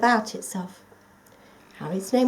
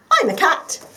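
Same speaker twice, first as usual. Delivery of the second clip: loud, higher, brash cat voice; 7 semitones higher, 8 dB louder than usual. Acted. A big tabby cat calls out to the lost toy, loud and brash. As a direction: boom it out like a big cheery tabby. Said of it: "said a loud voice".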